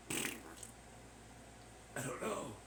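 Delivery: slow breathy whisper. Acted. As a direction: whisper it very slowly. Sudden unvoiced reply.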